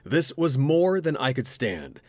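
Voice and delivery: ordinary delivery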